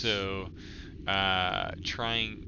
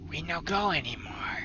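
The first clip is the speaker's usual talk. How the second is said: hushed goblin; 6 semitones higher than usual. Acted. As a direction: whisper the secret like a frightened goblin.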